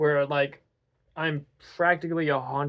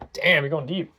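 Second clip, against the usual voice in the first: usual speaking voice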